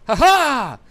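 triumphant shout. Acted. GM voices triumphant taunt.

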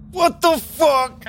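high, drawn-out yell; real shriek, each word stretched; outraged disbelief. Real, not acted. Player seeing the rerolled dice fail, outraged yell.

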